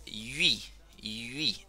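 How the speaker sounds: YUI with a French u gliding into i; YUI corretto. Shown as a demonstration. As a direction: demonstrate YUI, not "ui". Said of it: "No vamos a decir ui".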